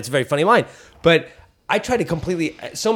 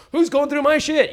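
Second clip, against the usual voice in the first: mock outrage; 11 semitones higher than usual. Acting out the shit bit.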